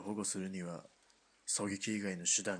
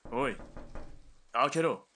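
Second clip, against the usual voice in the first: much louder, barked order; commanding shout; 4 semitones higher, 6 dB louder than usual. Acted. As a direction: shout through the door to open it. Colonel bangs on a door. (扉越しに怒鳴って命令する)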